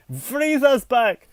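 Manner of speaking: high, loud mock-dramatic announcement; hammy cry of alarm. Mock-announcing repetitive Super Saiyan plots.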